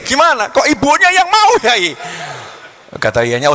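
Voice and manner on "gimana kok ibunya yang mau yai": high, loud baffled whine; bewildered complaint. Acted. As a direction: protest to the teacher in disbelief. Suitor complains to his kiai.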